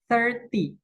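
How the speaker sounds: thirty pitched high, stress on thir then falling. Shown as a demonstration. Teacher contrasts with thirteen, stress on thir. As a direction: hit thir in thirty and drop. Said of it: "penekanannya di ter".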